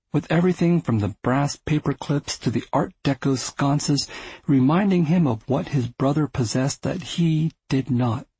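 ordinary delivery

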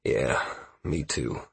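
low, slow, soft mumble; mumbled. A narrator's reading. Halcyon agreeing he is too tired, low mumble. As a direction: mumble it wearily, well under your voice. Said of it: "mumbled in agreement".

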